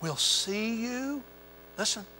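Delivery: gentle fading reassurance; soft tender promise. Acted. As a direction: draw them in with a near-hush. Voicing Jesus' gentle promise.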